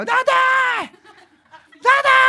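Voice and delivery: put-on high, loud little girl's call from far off; child calling out. Daughter calling her daddy from afar, a high child's shout. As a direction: shout across the water like a small child.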